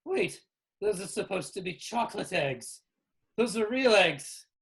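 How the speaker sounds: high, loud, bewildered exclamations; Winky Wally. Acted. Winky Wally discovers the eggs are real, high alarmed voice. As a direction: cry out in alarm at a puzzling discovery. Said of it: "where Winky Wally says".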